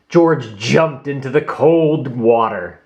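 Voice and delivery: usual speaking voice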